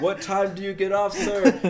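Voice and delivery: high, loud scammer impression; the pushy caller demanding details.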